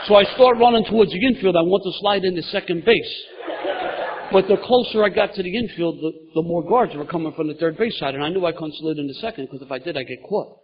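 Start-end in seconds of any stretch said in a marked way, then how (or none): 0.1-6.0 loud laughing excitement. Real, not acted. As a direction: laugh through the telling. Retelling his wild field run.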